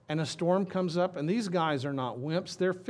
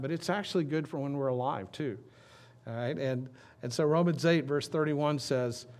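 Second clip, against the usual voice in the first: ordinary delivery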